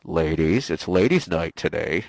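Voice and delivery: very low, loud announcer voice; mock announcer. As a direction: drop deep and boom out the promo. Mock announcer for ladies' night.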